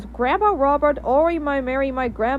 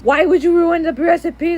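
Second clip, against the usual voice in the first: higher, louder angry yell at the ruined recipe; angry shout; 5 semitones higher, 4 dB louder than usual. Acted. Miguel yelling at dad for ruining the recipe, loud and angry. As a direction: shout it out, outraged. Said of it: "angry about him and shouting".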